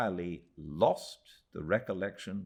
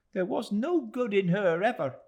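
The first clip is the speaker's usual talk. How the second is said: high, louder voice of a cross woman; scornful Mrs Joram; 7 dB louder than usual. A narrator's reading. Mrs Joram to David, high loud scorn. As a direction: condemn her outright, high. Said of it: "cried Missus Joram angrily".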